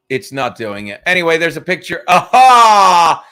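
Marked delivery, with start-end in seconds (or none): 2.1-3.2 loud, triumphant cry; triumphant exclamation. Real, not acted. Streamer finds the picture, loud and triumphant. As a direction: burst out on finding it.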